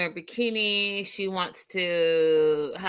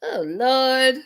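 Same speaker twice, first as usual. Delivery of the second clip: exasperated cry; 5 semitones higher, 5 dB louder than usual. Retold or imitated. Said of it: "I was like".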